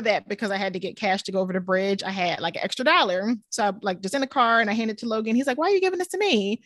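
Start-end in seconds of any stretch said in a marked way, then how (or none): 5.6-6.6 put-on lightly higher, quick kid's question; a hint of a puzzled little boy; retold child's question. Son asking mom about the dollar, lightly voiced child.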